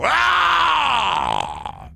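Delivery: mock yell into the quarry. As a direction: let out a big echoing holler. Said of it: "I do like to go".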